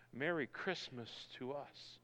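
ordinary delivery

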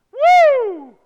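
high, loud whoop. Real, not acted. Preacher whooping after his shout, high whoop.

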